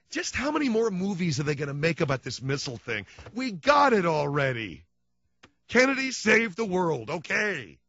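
a touch of the fed-up wife; wife voiced. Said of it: "my wife says".

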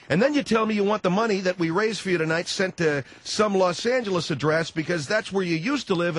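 ordinary delivery

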